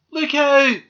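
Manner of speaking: high, loud warning shout; alarmed cry. A narrator's reading. Doll to squirrel as aerial bends, alarmed cry. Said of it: "cried Marianne".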